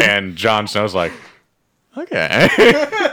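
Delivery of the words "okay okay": high laughing okay; a giggly mock Jon Snow.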